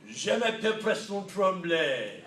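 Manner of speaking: slow, confident "Je m'appelle Preston Tremblay" with a hammed-up French accent. Shown as a demonstration. Speaker showing the right way to give his name with French flair. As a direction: feel French and say it proudly. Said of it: "then you can say with confidence".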